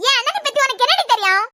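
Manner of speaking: high-pitched indignant defence of a brother; heated, high-voiced confrontation; angry challenge. Sibling angrily defends the brother.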